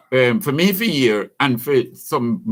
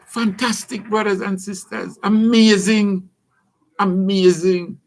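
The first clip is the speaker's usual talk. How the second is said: amazed emphasis; 8 semitones higher than usual. Real, not acted. Marvelling at the quick learner.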